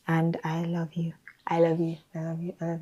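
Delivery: soft whisper, barely voiced; whispered take. Shown as a demonstration. Trying takes for camera, whispered line. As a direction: breathe it out tenderly. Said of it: "choose the one that I said it the best in".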